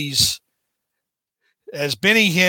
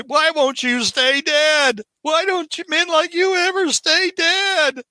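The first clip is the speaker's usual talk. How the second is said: voicing Liz; 12 semitones higher than usual. Reading Liz's outburst.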